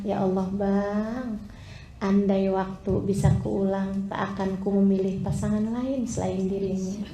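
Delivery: a hint of a tender wife, gently; tender words to husband. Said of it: "kemudian kamu bilang". (istri berkata lembut dan pelan kepada suaminya)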